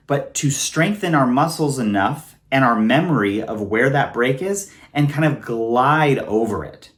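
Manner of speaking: ordinary delivery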